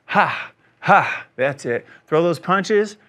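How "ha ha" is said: loud kiai shout. Shown as a demonstration. Coach to class, shouting the ha with each punch. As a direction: punch the ha out from the core. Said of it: "continue to go with the ha".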